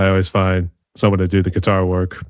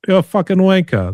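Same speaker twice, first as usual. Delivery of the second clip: high, punchy mock-British insult; hammed-up English accent; bad English accent; 11 semitones higher than usual. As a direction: hurl it in a fake British voice. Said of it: "that English sort of accent".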